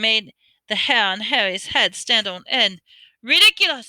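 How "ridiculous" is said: high, loud scream. A narrator's reading. Boy facing the banshee, screaming the spell. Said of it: "screamed Seamus".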